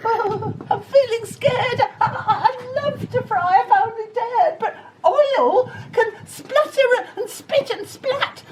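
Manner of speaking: very high, stammering frightened voice with giggles; scared cook. Acted. Cook asked to dig, very high stammering fright. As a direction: quaver it, heart thumping. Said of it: "the cook declared".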